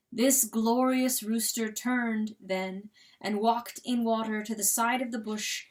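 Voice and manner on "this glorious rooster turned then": ordinary delivery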